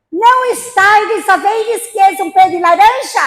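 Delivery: imitating an angry old lady scolding; old lady's retort. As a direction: snap back like a cross old woman. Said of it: "Olha o que que ela falou".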